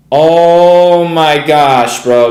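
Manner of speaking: long-drawn, loud exclamation; very slow, loud groan of disbelief; stunned disbelief. Real, not acted. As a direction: stretch it right out in real disbelief. Watching the trade turn suddenly.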